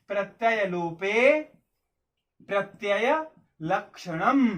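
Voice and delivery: slow, drawn-out recitation with a pause midway; measured dictation pace, stretching each word. Shown as a demonstration. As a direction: dictate it slowly for note-taking, word by word. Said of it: "लिख लीजिए, बहुत important है".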